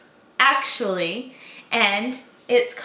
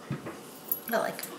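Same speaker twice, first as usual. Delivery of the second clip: breathy whisper, slowed down; whispered aside; 14 dB quieter than usual. Real, not acted. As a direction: whisper it under your breath. Disliking roly-polies, aside.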